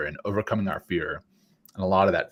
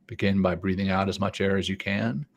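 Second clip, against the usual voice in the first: guided breathing. Acted. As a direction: lead the breaths gently. Leads breathing exercise.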